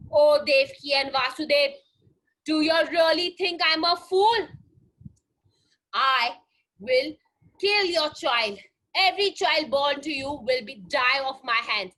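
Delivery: loud, menacing king's threat; loud villain threat. Acted. Kans threatening Devki and Vasudev, loud and menacing. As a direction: threaten them like a wicked king.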